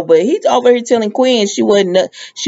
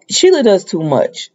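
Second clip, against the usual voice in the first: normal talking voice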